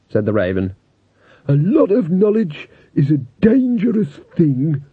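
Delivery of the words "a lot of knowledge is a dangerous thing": higher, put-on old skull voice; a chatty skull pronouncing wisdom; skeleton voice. A narrator's reading. Talking skull character.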